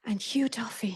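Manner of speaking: soft, conspiratorial whisper of the name; playful whisper. Real, not acted. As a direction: let the name out under your breath. Names her boss conspiratorially.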